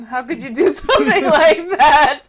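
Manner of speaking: laughing disbelief. Real, not acted. Friends laughing at the narrator's fake accent.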